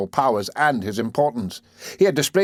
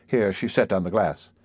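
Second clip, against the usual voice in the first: ordinary delivery, nothing marked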